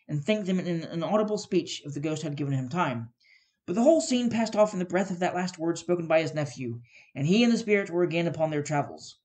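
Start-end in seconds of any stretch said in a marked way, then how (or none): none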